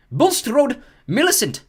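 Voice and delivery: loud, high name call. Acted. McGonagall calls a pupil forward, loud and high. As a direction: call the name across the hall. Reading names to the hall.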